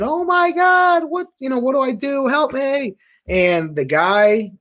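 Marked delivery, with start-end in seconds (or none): 0.0-3.0 mock-panicked cry, pitched way up; high-pitched imitation of a panicked rookie; retold panicked scream. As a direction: act out screaming for help, high and stretched at first. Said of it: "I'm start screaming".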